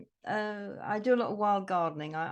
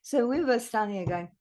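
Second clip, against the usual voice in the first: ordinary talk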